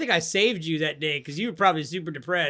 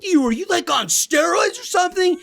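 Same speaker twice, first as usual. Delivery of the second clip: put-on higher, louder, slowed shouting kid; astonished son; 12 semitones higher, 5 dB louder than usual. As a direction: imitate a kid stunned by dad's gaming. Son reacting to dad's play.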